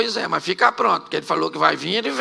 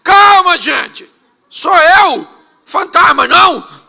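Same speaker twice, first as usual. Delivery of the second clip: high, loud call to calm everyone; acted far-off shout, each phrase launched high; Jesus shouting from outside; 8 semitones higher, 10 dB louder, slower than usual. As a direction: holler it across the water to a panicking crew. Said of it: "Jesus gritou lá de fora".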